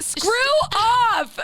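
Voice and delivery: high, drawn-out comic dismissal; mock exasperated retort. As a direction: fling it out as a playful brush-off. Acted retort to bandwagon fans.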